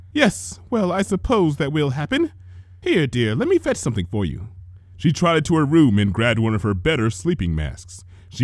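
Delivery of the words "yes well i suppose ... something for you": lightly higher, refined big-sister voice; a hint of a fussy older sister; gentle big sister tending a sick sibling; Rarity's voice. A narrator's reading.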